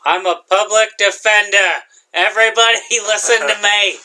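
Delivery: pompous announcement in a put-on voice; Sandy voice. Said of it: "That's my Sandy voice".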